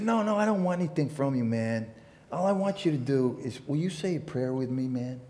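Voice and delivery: easygoing hippie asking a favour, lightly done; lightly voiced hippie. Storyteller voicing the hippie at the diner, mellow and friendly.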